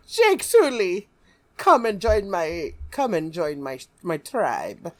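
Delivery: high, loud mock Na'vi accent; Na'vi girl accent. Na'vi girl calls Jake Sully to her tribe in her accent. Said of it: "you're doing that accent".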